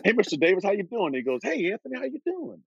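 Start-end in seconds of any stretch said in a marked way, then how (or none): 0.0-1.1 higher, eager, starstruck hello to the boss; retold excited greeting, pitched up. Greeting team owner, starstruck. 1.4-2.6 lightly voiced friendly owner, pitched up; a hint of the boss saying hi; retold casual hello, higher; owner's greeting. Voicing Al Davis greeting him.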